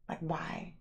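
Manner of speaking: whispered why. Real, not acted. Horrified at live action remakes.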